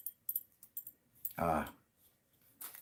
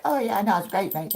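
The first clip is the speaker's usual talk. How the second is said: put-on Australian accent; 13 semitones higher, 4 dB louder than usual. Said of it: "they're just like".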